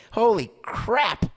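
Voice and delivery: indignant player. Retold or imitated. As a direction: play the player who feels left behind. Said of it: "Correa will look at Scotty Boras and say".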